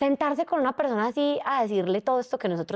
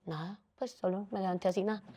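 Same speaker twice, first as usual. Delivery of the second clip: softer, withdrawn mumble; mock sulky reply; 9 dB quieter than usual. Woman brushing off her partner's question, sulky. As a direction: answer like you don't want to be asked. Imitating own moody reply.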